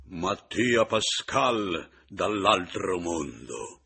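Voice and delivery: slow, booming, ghostly shout; loud cry from beyond the grave. A narrator's reading. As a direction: advance and bellow it, drawn out. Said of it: "Ma avanzai gridando".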